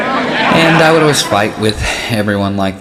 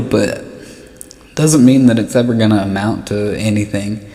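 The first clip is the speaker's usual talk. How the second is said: ordinary talk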